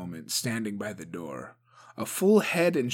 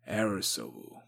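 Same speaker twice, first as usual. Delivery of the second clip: plain reading voice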